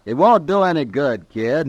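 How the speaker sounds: loud objection. Acted. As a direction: shout him down before he goes. Shin loudly objects as Neil leaves.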